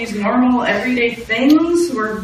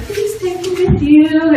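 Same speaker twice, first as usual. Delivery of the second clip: pleading; 9 semitones higher, 3 dB louder, slower than usual. Retold or imitated. Teen begging to tag along.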